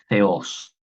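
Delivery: "Theos" opening on a th sound, like Castilian Z; classical-style Greek pronunciation. Shown as a demonstration. Teacher showing the word for God, classical th pronunciation. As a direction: say "Theos" as THE-OS with tongue-between-teeth th. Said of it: "más cercano al griego clásico".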